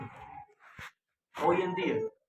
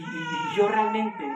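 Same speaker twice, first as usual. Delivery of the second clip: excited outburst; 7 dB louder, much slower than usual. Real, not acted. Joyful outburst before the congregation.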